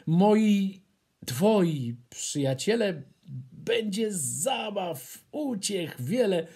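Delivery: pleading, rising eager voice; a lightly voiced eager hare. A narrator's reading. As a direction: coax him to stay. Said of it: "Prosi Łączek".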